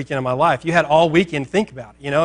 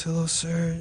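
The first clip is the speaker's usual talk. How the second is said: softer, sheepish mock greeting; not really looking; 9 dB quieter, slower than usual. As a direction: greet him softly, avoiding contact. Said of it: "like, not really looking".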